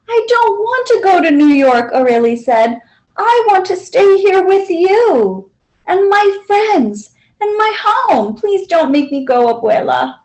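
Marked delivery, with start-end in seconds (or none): 0.1-1.9 put-on higher, pleading child's voice; lightly voiced distressed child; upset little girl. A narrator's reading. Child refusing to leave. 3.2-10.2 put-on a touch of childlike distress; pleading child. A narrator's reading.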